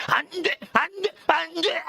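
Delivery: repeated high, forceful cries of refusal; intense prohibition. Shown as a demonstration. Preacher showing how strong the original ban is, intense prohibition. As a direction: hammer out each refusal at a high pitch. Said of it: "이렇게 얘기하는 거예요".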